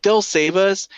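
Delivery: high, loud hopeful cry; a kid sure the heroes will save them. Retold or imitated. Kids hoping heroes save them.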